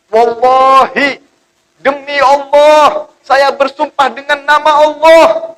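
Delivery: high, loud, slow impassioned oath; swearing devil. Acted. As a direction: swear by God with fake fervour. Devil swears false oath to Adam.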